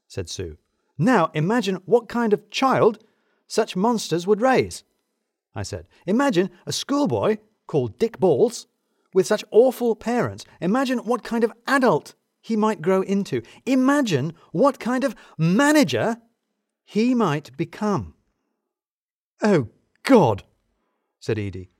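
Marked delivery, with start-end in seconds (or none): 1.0-4.8 high, slow, emphatic speculation; horrified rant. A narrator's reading. Mounting horrified rant in dialogue. 6.0-18.1 high, emphatic, building rant; mounting alarm. A narrator's reading. Rant builds through each imagine. 19.4-20.4 loud, high groan of dread; dawning horror. A narrator's reading.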